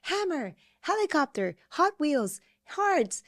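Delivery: çok daha tiz, hevesli çocuk sesi; high-pitched kid listing answers one by one; eager child. Retold or imitated. Acting out child's quick answers.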